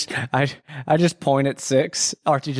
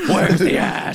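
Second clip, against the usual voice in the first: zombie; 10 semitones higher than usual. Acted. Zombie voice repeated, high.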